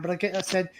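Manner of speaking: ordinary delivery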